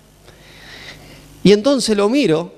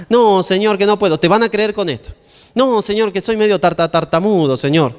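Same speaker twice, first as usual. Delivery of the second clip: reluctant Moses. Acted. Moses making excuses to the Lord, mock pleading. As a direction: act out a reluctant excuse-maker. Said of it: "Me lo imagino a Moisés así".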